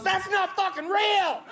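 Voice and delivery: high, slow indignant blurt. Retold or imitated. Inner know-it-all objecting to the movie, high and slow. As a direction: let the pedantic inner voice object, slowly and high.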